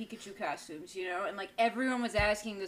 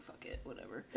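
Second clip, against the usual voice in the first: whisper; 12 semitones lower, 17 dB quieter than usual. Retold or imitated. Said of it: "I was just like".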